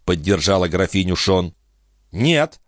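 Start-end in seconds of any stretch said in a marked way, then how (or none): 2.1-2.6 higher, firm no; abrupt, final denial; curt refusal. A narrator's reading. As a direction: cut the discussion off with one word. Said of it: "отрезал Жан".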